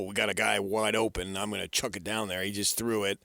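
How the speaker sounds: ordinary talk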